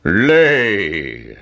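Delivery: drawn-out command in a giant's voice; giant's shout. Acted. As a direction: shout it long, like a storybook giant. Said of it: "shouted the giant".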